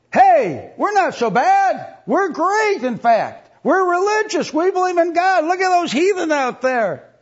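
very high, loud, hammed-up bragging; exaggerated high-pitched self-congratulation; mock boastful crowd. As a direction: crow it loudly like the self-righteous, mockingly. Voicing smug religious crowd.

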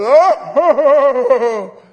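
a loud, high braying "Oh!" burst out as a laugh; a mocking laugh thrown through the church door to startle the people; horse laugh. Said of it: "I could give a horse laugh".

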